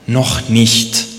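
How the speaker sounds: growling. A narrator's reading. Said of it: "Er knurrte nur".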